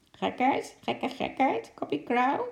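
high, squawking parrot voice; schreeuwende papegaai. A narrator's reading. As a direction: squawk it like a cheeky parrot, pitch shooting up at the end. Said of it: "de paar woorden schreeuwde". (hoge, krijsende papegaaienstem)

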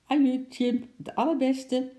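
high, loud little animal voice; squirrel's farewell. A narrator's reading. Squirrel bidding his chimp friend farewell, high and chirpy. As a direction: call goodbye in a high squirrel voice.

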